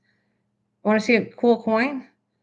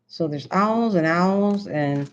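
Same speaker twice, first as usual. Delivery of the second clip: usual speaking voice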